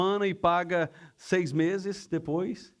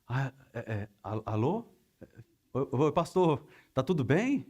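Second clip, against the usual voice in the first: stammering, lowered, hesitant hello; nervous phone answer; 4 semitones lower than usual. Acted. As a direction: act caught out, stumbling into a greeting. Acted nervous phone answer to pastor.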